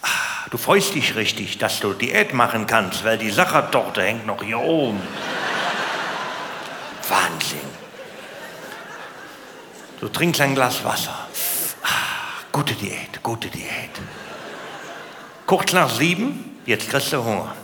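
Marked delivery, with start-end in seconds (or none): none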